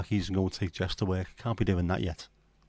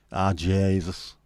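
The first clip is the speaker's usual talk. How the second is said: slow, drawn-out Irish exclamation; long-vowelled Irish dismay; Irish accent; 3 dB louder, much slower than usual. Acted. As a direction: sigh it out in stretched Irish vowels. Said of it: "in an Irish accent".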